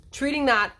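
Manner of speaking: normal talking voice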